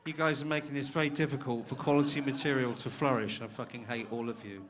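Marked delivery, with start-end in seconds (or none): none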